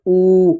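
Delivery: "ऊ" held long, a drawn-out "oo"; sustained "ऊ" for teaching; long vowel. Shown as a demonstration. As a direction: stretch "ऊ" out steadily. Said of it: "उसके बाद आता है बड़ा ऊ".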